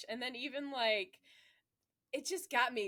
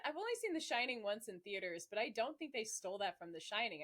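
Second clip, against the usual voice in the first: ordinary talk, nothing marked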